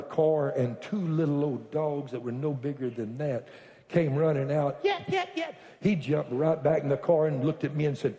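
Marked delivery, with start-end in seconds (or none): 4.8-5.6 very high, small-dog yaps; imitated dog yapping. Two little old dogs came running out, imitated yapping. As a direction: yap like a tiny excited dog.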